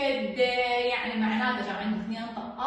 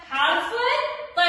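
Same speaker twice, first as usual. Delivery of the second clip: higher, slow حرف الط; slow emphatic answer; 10 semitones higher, 4 dB louder, slower than usual. Shown as a demonstration. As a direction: draw out the answer brightly. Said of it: "الضيف مالتي من هو؟".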